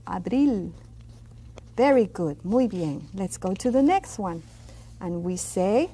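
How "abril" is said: "abril" with the L sounded; clear final L. Shown as a demonstration. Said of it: "Pronounce that L also".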